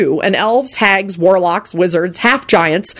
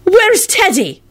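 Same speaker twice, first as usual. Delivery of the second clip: high, urgent demand; frantic high-pitched question; passionate shout; 13 semitones higher, much slower than usual. A narrator's reading. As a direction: demand to know where he is. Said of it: "Elizabeth shouted passionately".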